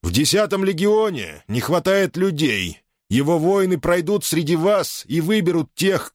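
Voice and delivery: roaring rallying speech. A narrator's reading. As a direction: proclaim it to the whole legion. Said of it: "стараясь говорить как можно громче". (громко, на весь легион)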